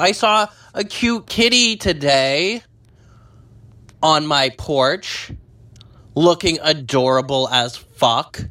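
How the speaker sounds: delighted fangirling about a cat; gushing. Real, not acted. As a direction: coo about the adorable cat. Gushing over a cute cat.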